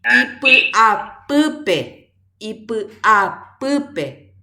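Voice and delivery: "Ipy'apýpe" stretched very slowly and loudly; teacher spells out "Ipy'apýpe" by ear, very slow and loud; slow model reading. Shown as a demonstration. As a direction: drag "Ipy'apýpe" out syllable by syllable for the class. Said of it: "¿Tiene más de una vocal tónica?".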